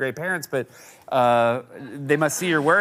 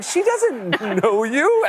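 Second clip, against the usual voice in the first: slow, higher-pitched incredulous correction; exasperated dad reply; 8 semitones higher, much slower than usual. Retold or imitated. Father retells answering his kids, slowly incredulous. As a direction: draw it out, pitched up, setting the kids straight.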